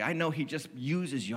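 ordinary delivery

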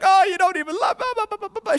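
high, hammed-up whining plea; mock whiny woman. Preacher mocking Delilah's pleading to Samson in a high whine. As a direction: whine it like a wronged lover, overdone. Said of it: "she just whines and cries".

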